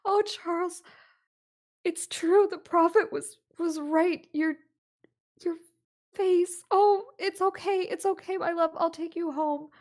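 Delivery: high, loud, tearful relief; wife overjoyed at reunion. Acted. Wife greeting husband back from the train, high and overjoyed. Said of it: "and she says".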